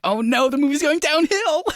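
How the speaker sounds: high-pitched mock alarm; exaggerated, high "oh no"; mock dismay. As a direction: wail it in comic dismay. Acting out own dismay at film.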